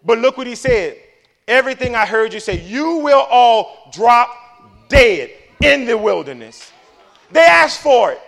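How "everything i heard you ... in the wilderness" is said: forceful proclamation. Acted. Reading God's verdict with force.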